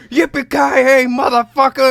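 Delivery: high, loud action-hero holler; hammed-up movie battle cry; Tracy Morgan quoting movies. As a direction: whoop the catchphrase at full tilt. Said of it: "Tracy Morgan's like".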